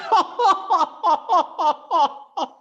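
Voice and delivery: very high, loud imitated belly laugh; imitated big laugh. Imitating Sergei's laugh for the audience, high and loud. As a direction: let out a huge mock laugh. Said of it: "he laughed like that".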